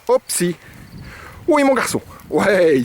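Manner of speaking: très aigu et fort, tout content; félicite le chien d'une voix haute et ravie; félicitation enjouée. Real, not acted. Félicite son chien au rappel. (félicitation aiguë et joyeuse)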